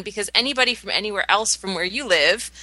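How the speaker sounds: ordinary delivery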